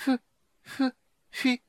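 high, choked stammer, can't get the word out; a tongue-tied kender gasping at a ghost; stammering in shock. Acted. Speechless kender stammering at the old man.

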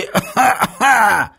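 high, strained coughs; coughing fit. Acted. Beaten Semyon, winded coughing fit. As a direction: cough hard after speaking.

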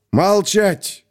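sudden bark, pitched high; barked order. A narrator's reading. Colonel silencing his captain, barking. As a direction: snap a command to silence. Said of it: "рявкнул вдруг Кисаев".

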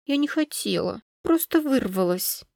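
high, soft apology; small, frightened pleading voice; trembling voice. A narrator's reading. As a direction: excuse yourself timidly to an angry father. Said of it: "дрожащим голосом произнесла".

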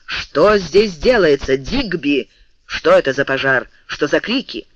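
usual speaking voice

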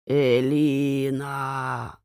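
slow, drawn-out call from nowhere; distant calling voice. A narrator's reading. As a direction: call the name slowly from afar. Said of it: "Вдруг услышала женский голос".

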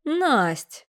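high, indignant call to a friend; indignant appeal. A narrator's reading. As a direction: call her name in protest. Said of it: "перевела она возмущённый взгляд". (возмущённо окликнуть)

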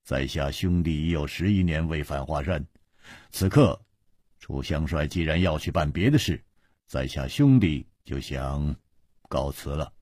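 low, soft, hesitant request; halting, awkward. A narrator's reading. Liu Biefei haltingly asks Chu Liuxiang for leave to go. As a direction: break it up with pauses, trailing off softly. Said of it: "讷讷地说".

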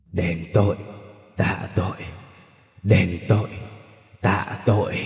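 low chant like a sutra; deep murky ghost voice. Acted. Vengeful ghost chants at the mother, low and droning. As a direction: intone it like a curse. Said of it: "giọng trầm đục của Vũ Phương".

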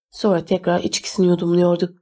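ordinary delivery, nothing marked

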